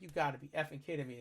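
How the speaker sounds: muttered disbelief. Retold or imitated. Seeing his car beep right where he parked, a fast soft mutter. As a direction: grumble it to yourself. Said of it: "And I'm like".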